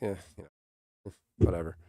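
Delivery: low, quiet, indifferent mumble; mock French shrug. Imitating the unimpressed French at Beatlemania, low and quiet. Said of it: "they're just like".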